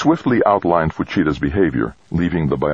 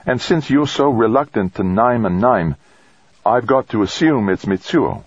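lightly voiced higher woman, probing; woman colleague; 6 semitones higher than usual. Colleague working out who the troubled man is, probing. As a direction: reason it out in a lifted woman's voice.

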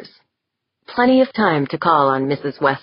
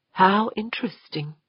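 soft, higher-pitched, held-in response; restrained; 5 semitones higher, 5 dB quieter than usual. A narrator's reading. Westfall hiding feeling at her sister's name, restrained. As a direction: say it softly with emotions clamped down. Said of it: "clamped down on her emotions".

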